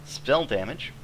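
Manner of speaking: ordinary delivery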